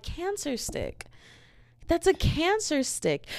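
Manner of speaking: high, drawn-out mock reproach; put-on high voice, stretched out; sing-song scolding. Acted reaction to smokers.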